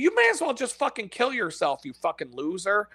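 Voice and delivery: usual speaking voice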